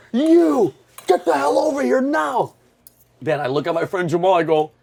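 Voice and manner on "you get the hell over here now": high, strained mock drill-sergeant yell; imitated barked command, pitched way up; drill instructor. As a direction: order a recruit over as an impersonated drill instructor. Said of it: "a short Mexican drill instru".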